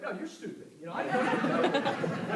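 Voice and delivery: mock-cocky comeback to a friend who scoffed; cocky attitude. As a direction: throw it back at him cocky and loud. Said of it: "an attitude".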